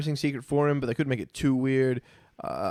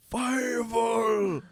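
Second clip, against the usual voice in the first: high, drawn-out calling shout; mock father mouse; 8 semitones higher than usual. Podcaster quoting An American Tail, drawn-out call. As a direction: cry out for a lost son. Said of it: "One of them's called Fievel".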